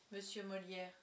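plain reading voice

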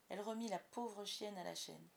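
ordinary delivery

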